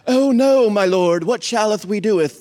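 loud, stagey King James voice; mock old-English drama. Shown as a demonstration. Preacher mocking a stiff Bible reading, hammed-up and loud. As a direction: overplay it like Shakespeare. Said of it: "That's, that's not how it sounded".

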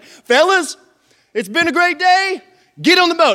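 high, loud rallying call; hearty call. Acted. Jesus ordering the fellas onto the boat, hearty loud call. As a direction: call out to the whole crew.

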